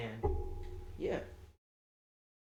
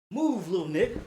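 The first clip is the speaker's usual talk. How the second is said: higher, mock brusque order; bossy command; 3 semitones higher than usual. Girlfriend shoving past the teller, bossy command. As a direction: imitate a girlfriend ordering someone aside.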